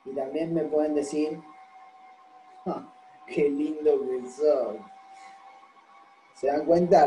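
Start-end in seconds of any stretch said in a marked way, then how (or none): 2.7-4.8 slow, dragged-out mock praise; dry, ironic fake admiration; sarcastic compliment. Shown as a demonstration. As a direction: draw it out so it means "you're ugly". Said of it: "me están diciendo que soy más feo".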